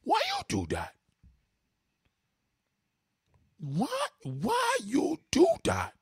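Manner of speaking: put-on high, drawn-out Haitian-accented protest; hammed-up Haitian dad, aghast; outraged foreign father. Any foreign father would be flipping, outraged Haitian accent. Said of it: "foreign father gonna be like".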